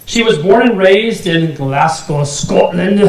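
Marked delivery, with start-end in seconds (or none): 1.6-3.0 hammed-up attempt at a Scottish accent. Host introducing the speaker's birthplace, in a mock Scottish accent. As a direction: put on a poor Scottish accent for the place names. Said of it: "I just love the accent".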